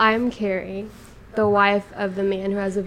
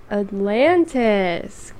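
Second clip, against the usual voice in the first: dramatic intro; much slower than usual. Acted. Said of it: "how I introed the podcast".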